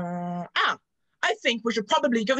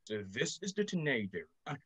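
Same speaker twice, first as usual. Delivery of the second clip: ordinary delivery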